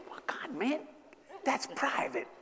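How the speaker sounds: soft, unvoiced embarrassed hush; whispered aside. Hushing his friend about private feelings, whispered. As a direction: whisper it so nobody hears. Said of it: "aside to friend".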